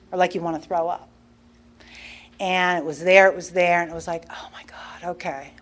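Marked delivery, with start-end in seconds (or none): 4.3-5.5 nearly voiceless, resigned whisper; whispered dread. Retold or imitated. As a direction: whisper it to yourself, as if bracing to confess. Retold dread of confessing the lie.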